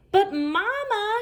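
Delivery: put-on a girl pleading against her mother; high, slow protesting little sister. A narrator's reading. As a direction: whine it out high and drawn. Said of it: "she protested".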